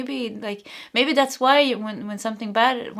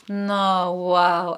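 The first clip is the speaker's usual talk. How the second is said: ordinary delivery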